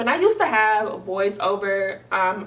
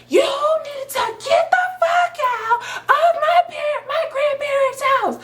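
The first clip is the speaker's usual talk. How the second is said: high, tearful scream; tearful scream ordering him out of her grandparents' house. Retold or imitated. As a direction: scream it at the top of your lungs. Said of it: "yelling at the top of my lungs".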